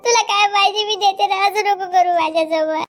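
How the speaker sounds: loud staged pleading for rescue; fake cry for help. Wife keeps faking the assault before villagers, loud pleading. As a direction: wail for help as if attacked. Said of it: "मी कल्ला करेल बरं".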